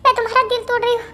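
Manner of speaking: soft, broken sobbing, rushing through the words; sobbing apology. Acted. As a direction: beg forgiveness while weeping quietly. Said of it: "तुम कब से रोए जा रही हो". (सिसकते हुए धीमी माफ़ी)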